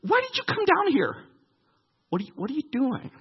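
put-on high, indignant jab of an older sibling; angry big brother. Oldest brother Eliab snaps at young David, high and scornful. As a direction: scold him like an annoyed older brother. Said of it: "Eliab's anger was aroused".

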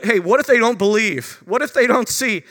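high, loud anxious pleading; loud anxious plea; pleading Moses. Acted. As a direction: beg like a worried Moses. Preacher voicing Moses' worried plea.

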